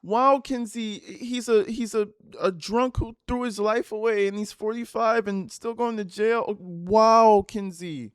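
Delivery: high, gushing fake-tearful voice; mock crying. Mocking Kinsey over her alcoholic pen pal, fake tearful. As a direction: put on being overcome with emotion. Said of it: "I'm gonna cry".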